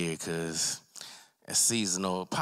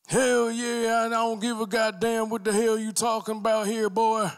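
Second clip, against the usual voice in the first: much higher, louder raspy wrestler shout; Stone Cold impression; 14 semitones higher, 5 dB louder than usual. [name] switching to Stone Cold, loud mock wrestler rant. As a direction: bark it like a beer-swilling wrestler. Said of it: "Stone Cold come out".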